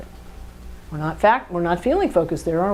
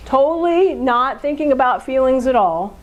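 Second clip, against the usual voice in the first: ordinary talk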